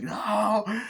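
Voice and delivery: high, drawn-out "no"; imitated horror-film protest; mock frightened cry. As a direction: wail as the fleeing heroine. Heroine flees, retold.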